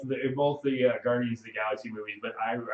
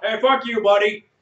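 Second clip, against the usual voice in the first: high, very loud retort; mock angry shout; 11 semitones higher, 14 dB louder than usual. Imitating Groot talking back, mock furious shout. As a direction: yell the curse in mock outrage. Repeats Groot's rude reply, louder.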